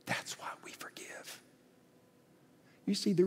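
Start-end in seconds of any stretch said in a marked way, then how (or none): 0.1-1.4 put-on soft near-whispered child answer; tearful whisper. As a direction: breathe it through tears. Tearful son answers softly.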